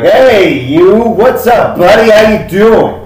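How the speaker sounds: high, loud, chummy shopkeeper greeting; gun dealer voice. Acted. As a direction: hail the customer like an eager salesman. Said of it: "He's like".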